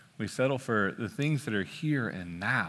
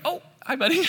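put-on high sweet greeting to a toddler; high, tender dad voice; cooing at a child; 10 semitones higher, faster than usual. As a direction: coo like a dad at his kid. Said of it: "And I'm like".

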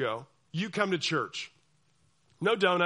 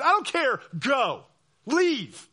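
louder, higher curt order; mock angry dismissal; 7 semitones higher, 7 dB louder, much slower than usual. Church leader ordering dissenter out, loud mock anger. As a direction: shove them out the door. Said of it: "You're like".

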